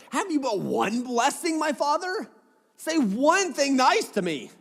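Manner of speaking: high, drawn-out plea; pleading son. Acted. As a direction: beg for just one kind word, pitch climbing. Voices Esau begging his father.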